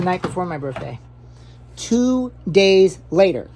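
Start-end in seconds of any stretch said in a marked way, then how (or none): 1.8-3.5 drawn out slowly, like a title card; mock movie-caption read; slow dramatic announcement. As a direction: spell it out slow for effect. Dramatic title-card style repeat.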